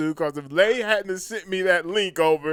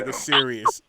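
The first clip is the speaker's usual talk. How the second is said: usual speaking voice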